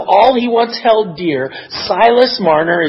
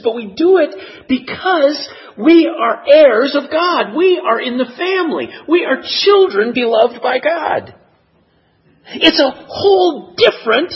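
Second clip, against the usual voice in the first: tearful preaching; 7 semitones higher than usual. Real, not acted. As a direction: preach it through real tears. Preacher moved to tears.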